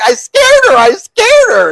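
very high, loud mock squeal; retold frantic shout. Child confesses to Dad running out, frantic squeal. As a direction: yelp it in comic panic.

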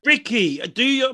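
usual speaking voice

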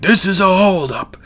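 high-pitched mock robber voice; imitated mugger. Bum holding up a teenager, imitated mugger. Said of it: "And he's like".

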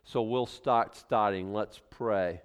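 ordinary delivery

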